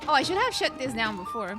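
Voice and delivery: ordinary talk, nothing marked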